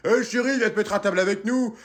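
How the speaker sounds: loud, casual husband call. Retold or imitated. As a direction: call her over lazily. Husband quoted calling. (mari qui appelle)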